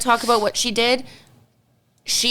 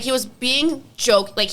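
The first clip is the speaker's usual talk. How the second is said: ordinary talk, nothing marked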